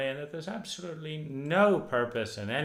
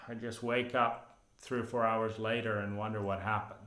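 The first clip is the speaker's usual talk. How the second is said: ordinary talk, nothing marked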